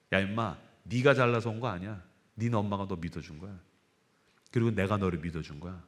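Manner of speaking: a quiet, fatherly scolding; God's gentle rebuke. Retold or imitated. God answers the pastor's prayer. (기도에 답하는 하나님의 낮고 조용한 꾸지람)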